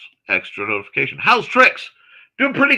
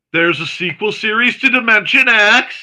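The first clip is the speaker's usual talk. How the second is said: excited outburst in a higher voice; Dalek; 5 semitones higher, 4 dB louder than usual. Real, not acted. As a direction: let excitement burst out, pitched up. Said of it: "I got excited and my, my inner Dalek came out".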